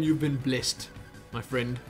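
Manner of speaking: ordinary delivery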